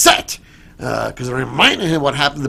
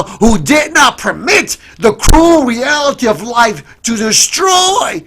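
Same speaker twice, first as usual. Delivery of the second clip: loud, high, rising sermon climax; a pastor driving home the Christmas miracle, full force; preaching at full force; 8 semitones higher, 7 dB louder than usual. Acted. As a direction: preach it out to the whole church.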